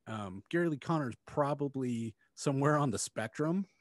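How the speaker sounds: ordinary talk, nothing marked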